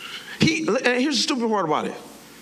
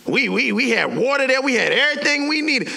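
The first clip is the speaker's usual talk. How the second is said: insistent, higher-pitched griping; complaining; 5 semitones higher than usual. Acted. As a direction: protest like a fed-up crowd. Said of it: "they start complaining the same way".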